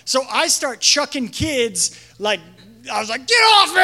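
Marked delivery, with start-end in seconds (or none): 3.3-3.8 sharp high yell to get off; shout. Retold or imitated. Youth leader retelling kids jumping on him, loud yell. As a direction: bark it at the kids piling on.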